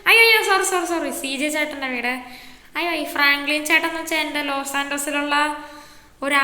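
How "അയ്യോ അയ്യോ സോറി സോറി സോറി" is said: sudden high, loud oh-no; startled apology. Acted. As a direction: blurt it out, caught out. Kid caught in a mix-up.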